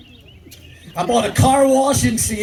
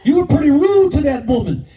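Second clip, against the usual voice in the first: scolding the driver; 7 semitones higher than usual. Retold or imitated. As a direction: call the driver out for rudeness. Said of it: "So I told the man".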